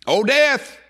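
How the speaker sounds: high, loud challenge to death; ringing preacher's taunt; addressing death. Acted. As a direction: call out death from the pulpit. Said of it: "he's addressing death".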